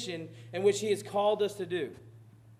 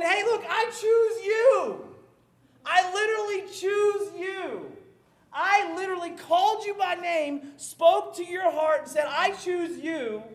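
voicing God; 8 semitones higher, 6 dB louder, slower than usual. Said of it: "he's going".

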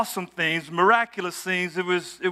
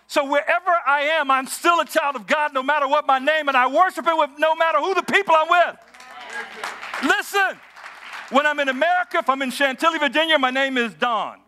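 higher, fervent preaching climax; 5 semitones higher than usual. Acted. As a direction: rise up and proclaim to the congregation.

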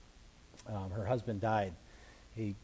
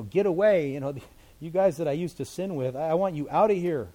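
loud dismissal; 5 semitones higher, 7 dB louder than usual. Acted. David voiced dismissing old companions.

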